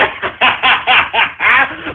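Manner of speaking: staccato self-amused cackle; long run of ha-ha laughter; cackling. Real, not acted. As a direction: cackle at your own boast. Laughs at own boast.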